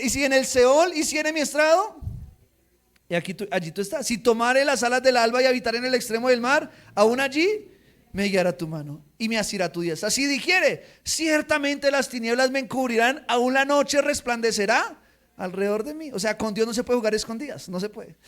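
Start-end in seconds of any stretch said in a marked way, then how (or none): 0.0-2.0 loud, measured psalm reading; reading Psalm 139 aloud with fervor; scripture reading. Acted. 4.1-16.1 loud, measured psalm reading; reading Psalm 139 aloud with fervor; scripture reading. Acted.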